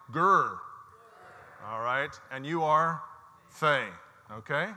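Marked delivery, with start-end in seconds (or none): 0.1-0.6 louder growled "Gur" like a tiger; lion growl. Shown as a demonstration. Preacher showing the congregation how to roar it, growled. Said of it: "Just like, you know, the tiger or the lion".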